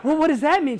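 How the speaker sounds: half-laughing bewildered question; baffled. Retold or imitated. Speaker voices his confused reply to wife, high and laughing. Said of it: "I thought".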